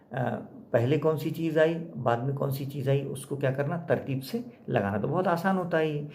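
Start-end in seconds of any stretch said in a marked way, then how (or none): none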